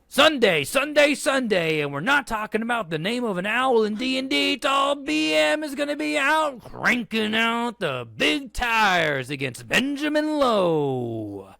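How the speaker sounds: booming hyped announcer, drawn out; monster truck ad. Acted. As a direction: sell it like a Sunday Sunday Sunday radio spot. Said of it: "reading this as a monster truck advertisement".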